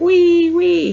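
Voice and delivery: very high, loud gleeful wee; child's playful squeal. Retold or imitated. Kai circling on his bike, squealing gleefully, very high. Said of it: "is Kai going".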